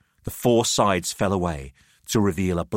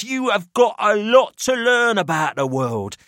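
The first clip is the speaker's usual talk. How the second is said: higher, patronising worldly man; Pelham's voice; 11 semitones higher, 5 dB louder than usual. Acted. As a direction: lecture the naive boys from above.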